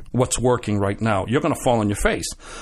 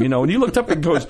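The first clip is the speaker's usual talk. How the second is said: ordinary talk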